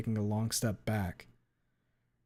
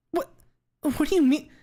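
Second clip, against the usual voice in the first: much higher, louder squeak of shock; startled; 8 dB louder than usual. A narrator's reading. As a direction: blurt it out, caught off guard. Trish startled.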